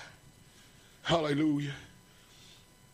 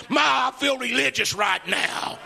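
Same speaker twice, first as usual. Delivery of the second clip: higher, louder, slow shout; shouted praise; 9 semitones higher, 6 dB louder, slower than usual. Retold or imitated. Prophet backs up and exclaims to the crowd, slow loud shout. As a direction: throw your head back and proclaim it. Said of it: "he backed up and said".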